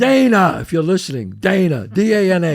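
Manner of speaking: booming public call-out to an ex; loudly name and shame. Real, not acted. Calls out his ex on air.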